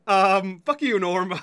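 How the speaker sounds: ordinary talk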